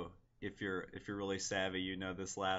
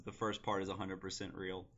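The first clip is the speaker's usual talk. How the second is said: ordinary delivery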